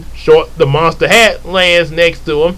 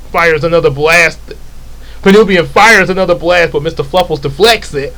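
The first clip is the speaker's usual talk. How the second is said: usual speaking voice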